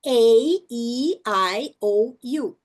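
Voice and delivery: English letter names "A, E, I, O, U", each drawn out; teacher's deliberate "A, E, I, O, U"; long vowels. Shown as a demonstration. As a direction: recite "A, E, I, O, U" one by one. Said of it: "o nome da letra".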